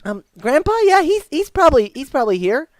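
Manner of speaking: put-on high, loud little-boy voice; helpful young boy. Boy answering stranger about Grandpa, young and helpful. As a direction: answer as an eager kid.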